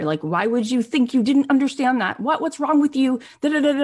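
mock dressing-down rising into an impatient rant; berating. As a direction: imitate someone scolding in disbelief. Guy berating her, retold.

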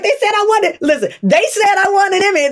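high, breathless excitement; thrilled announcement to mom; excited. Retold or imitated. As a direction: blurt it out as if you can't believe it. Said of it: "I'm like".

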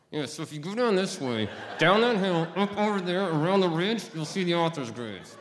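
jaw wired shut, words squeezed out; through gritted teeth. Retold or imitated. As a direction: talk without opening the mouth, high and loud. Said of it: "with my mouth wired shut".

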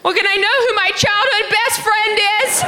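very high, pleading mom impression; put-on squeaky high mom question; mom's voice. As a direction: imitate mom begging to know, way up high. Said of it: "my mom goes".

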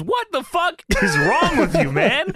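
high, loud berating; exasperated mock yell. Host retelling manager berating wrestler, exasperated mock yell. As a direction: lay into him, acted up for laughs.

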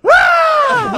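high, loud rally yelp; imitated scream. Host imagining Dean as a hype man, wild yelp. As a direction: cut loose with a crazed yelp. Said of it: "He'd be like".

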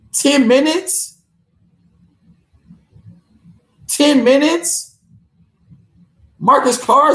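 venting real frustration over the loss; mad as hell. Real, not acted. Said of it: "I'm really mad".

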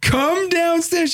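high, drawn-out retold call. Husband to wife upstairs, slow high calling. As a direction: stretch it out like calling up the stairs.

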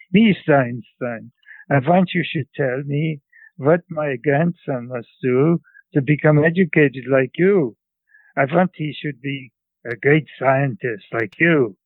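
put-on insistent old woman with a thick Central European accent; grandmother's Central European accent. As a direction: make a demand as a Central European immigrant grandmother. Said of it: "imitate my grandmother, uh, my grandmother's, uh, English".

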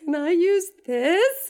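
high and very slow, timid ask; sheepish plea. Retold or imitated. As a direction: ask it small and hopeful. Offering unemployment card sheepishly.

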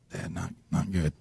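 a hushed, sheepish son admitting bad grades; small guilty voice confessing to dad. Son in back seat confessing to dad, hushed and sheepish.

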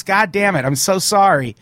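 ordinary delivery

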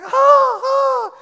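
put-on high shouted child's yelps; pained yelp. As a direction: yelp like the hurt boy being carried out. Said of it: "He's like".

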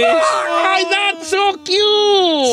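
high, very drawn-out cooing; exaggerated high "so cute" gush; gushing squeal. Real, not acted. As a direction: squeal over something adorable, stretching each word. Gushing over cute pet nicknames.

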